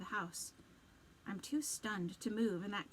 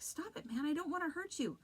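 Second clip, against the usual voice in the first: higher urgent plea; calm him down firmly; 8 semitones higher than usual. Acted. Restraining a thrashing friend.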